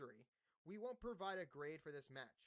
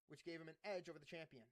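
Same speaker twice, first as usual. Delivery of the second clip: ordinary talk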